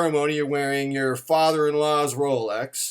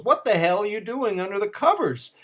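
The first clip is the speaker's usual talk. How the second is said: mock-indignant, higher-pitched wife; wife's indignant question; 6 semitones higher than usual. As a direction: play the wife catching him out. Acted wife confronting hiding husband.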